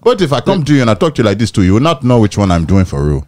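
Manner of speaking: high, fast African accent. Shown as a demonstration. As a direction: rattle it off in a lilting African accent. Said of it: "I have multiple African accents".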